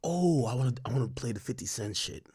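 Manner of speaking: ordinary talk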